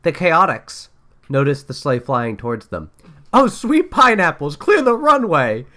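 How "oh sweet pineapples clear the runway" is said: much higher and louder alarm; panicked shout. Acted. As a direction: yell a warning while running. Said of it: "cried Vector as he ran for cover".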